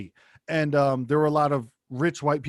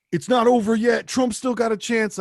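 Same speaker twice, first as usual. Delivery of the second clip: higher, louder, insistent; mocking conspiracy theorist; 6 semitones higher, 3 dB louder than usual. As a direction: lightly parody the true believer. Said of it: "of just like".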